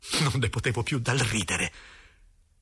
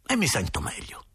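ordinary delivery

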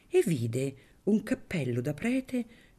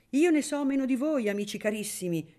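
bright exclamation, higher in pitch; higher, exclaiming to his dear friends; 9 semitones higher than usual. A narrator's reading. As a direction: exclaim it with lively surprise. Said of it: "esclamò don Ciccio".

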